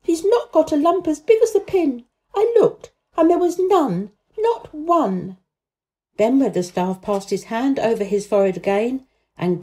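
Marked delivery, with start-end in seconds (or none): 0.0-5.4 high-pitched, raised, insistent defence; indignant near-shout. A narrator's reading. Girl defending the boy to the gardener, indignant cry. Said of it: "almost shouting up the wall in her first indignation".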